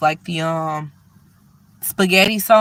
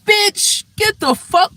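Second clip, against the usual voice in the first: high-pitched loud yell at the tailgater; mock yell; 5 dB louder, slower than usual. Yelling back at the woman on her heels, shrill mock yell. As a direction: shriek it at her over your shoulder. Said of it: "I was about to turn around and".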